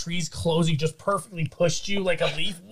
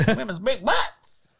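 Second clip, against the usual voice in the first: higher, slow put-on Sinbad voice; goofy Sinbad echo; 7 semitones higher, slower than usual. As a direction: stretch each word out in a silly voice. Echoing the imagined Sinbad line.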